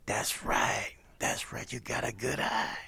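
soft, breathless greeting; imitating Miles's whispered rasp; raspy whisper. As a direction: rasp it barely above air.

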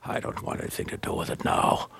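soft, half-whispered refusal; low disowning reply. Retold or imitated. Inspector washing his hands of the suspect, hushed reply. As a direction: drop to a hushed, breath-only voice. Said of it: "and then I'd say".

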